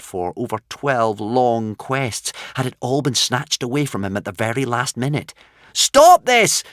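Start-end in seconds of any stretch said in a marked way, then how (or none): 5.8-6.6 high, loud desperate yell; cried out. A narrator's reading. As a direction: shout up at the dragon helplessly. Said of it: "cried Hiccup".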